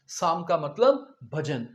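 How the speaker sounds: normal talking voice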